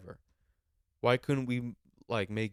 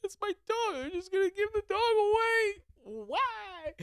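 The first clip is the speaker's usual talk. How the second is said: put-on much higher, strained whiny kid's wail; crying kid. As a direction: sob it out like a heartbroken little boy watching his dog leave. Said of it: "'Cause I'm just like".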